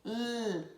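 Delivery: L as a long held "lll"; isolated L sound. Shown as a demonstration. Teacher introducing the letter L, its sound held long. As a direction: sustain the tongue-tip "lll", no letter name. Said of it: "a língua vai pro céu da boca".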